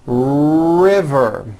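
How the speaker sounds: RIVER with a long strong opening R. Shown as a demonstration. Teacher modelling practice word, strong R in RIVER. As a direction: draw out the R, then a buzzed V in RIVER. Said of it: "Notice the strong R".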